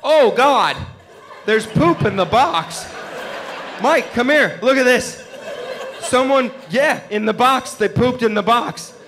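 a prissy cat freaking out over poop; high, loud, appalled cat calling Mike; horrified cat. Retold or imitated. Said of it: "My cat was horrified".

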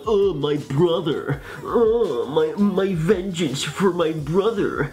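mock-tragic moaning, slow and drawn out; Sad Man. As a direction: ham up a brooding avenger's lament. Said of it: "you have Sad Man who's like".

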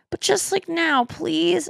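playful pleading. Retold or imitated. Woman begging boyfriend to look now, playful retold plea. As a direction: coax in a small, high begging voice.

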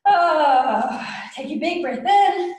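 high, loud call to breathe in; teacher hyping the volcano breath; big excited cue. Shown as a demonstration. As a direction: pump the kids up for the breath. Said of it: "This is our tantrum breath".

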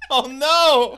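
very high, loud wail; laughing mock horror. Real, not acted. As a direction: cry out in amused dismay.

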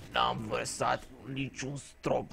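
put-on soft, slow, raspy old man's denial; hoarse old man. Old patient denies spilling to the nurse, soft slow rasp. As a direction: mumble it like a frail old patient. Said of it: "cu vocea lui răgușită".

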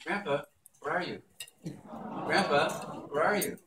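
lightly voiced toddler calling out; imitating grandson. Grandson walking the house looking for Grandpa, lightly imitated child's call.

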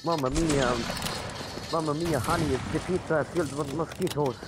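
jolly Italian pizza chef. Pretend. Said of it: "you're not Luigi".

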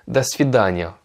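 da svidania said slowly with stress on da; slow model da svidania; careful Russian. Shown as a demonstration. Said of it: "littéralement c'est au revoir".

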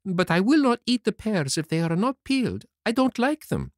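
put-on high-pitched childish refusal; stubborn puppet Pinocchio. Puppet refuses unpeeled pears, high stubborn voice. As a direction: dig in with a high little puppet voice.

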